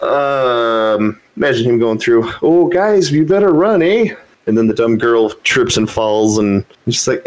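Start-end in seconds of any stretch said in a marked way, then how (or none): 2.4-4.1 put-on a higher, cheerful Canadian accent with a lilting "eh?"; mock Canadian killer. Imagining a Canadian Jason, in a chirpy Canadian accent. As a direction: play a friendly Canadian slasher warning his victims. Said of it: "We've made fun of Canadians".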